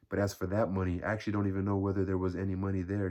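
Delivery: usual speaking voice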